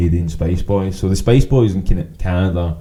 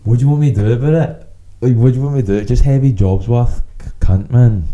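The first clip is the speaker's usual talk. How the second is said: higher-pitched, indignant rant; exasperated outburst; 4 semitones higher, 4 dB louder than usual. Acted. Fan venting about the steward who policed his mask, exasperated. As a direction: throw it out in raised, fed-up exasperation.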